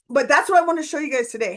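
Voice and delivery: fast, tumbling words; pressured rushing speech. Real, not acted. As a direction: rush it out, all in one breath. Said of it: "I'm talking a mile a minute".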